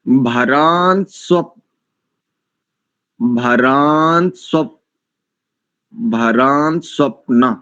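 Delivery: भरांस्वप्न built up slowly, one piece at a time; slow syllable drill. Shown as a demonstration. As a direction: drag out भरांस्वप्न, adding a syllable each time. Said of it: "फिर से देखो इस शब्द को".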